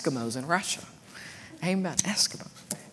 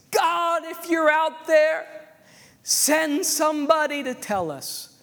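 high, drawn-out cry to God; imitated calling out; 11 semitones higher, slower than usual. Villagers by the fire to God, imitated plea. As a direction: call slowly to the far heavens. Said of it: "every night they say".

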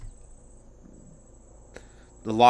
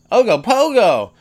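excited cry; 8 semitones higher, 5 dB louder, slower than usual. Acted. Exclaims the monster's name.

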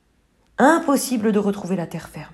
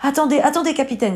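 supplique aiguë et pressée; arrêter le capitaine à tout prix; s'écrie; 6 semitones higher, faster than usual. Acted. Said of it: "s'écrie Carmela". (poulette qui supplie le capitaine, voix aiguë)

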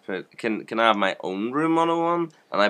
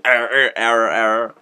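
robot error voice; 3 semitones higher, 7 dB louder than usual. Pretend. Playing a broken computer.

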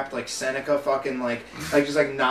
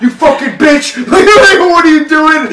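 much higher, louder screamed insult; mock screaming boyfriend; 11 semitones higher, 16 dB louder than usual. Junkie boyfriend screaming at his girlfriend at work, mock yell. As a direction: scream it at her across the store. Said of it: "just like".

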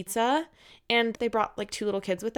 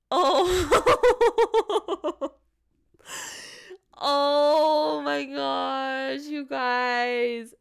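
bursting into laughter; 4 semitones higher, much slower than usual. Real, not acted. Host reacting to the listener's story, bursting out laughing. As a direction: crack up at the letter.

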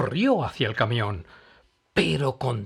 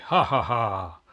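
loud mocking belly laugh; mocking guffaw; 5 dB louder, slower than usual. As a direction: laugh it off like a greedy villain. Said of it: "riendo a carcajadas".

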